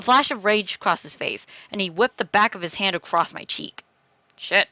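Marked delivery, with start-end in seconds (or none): none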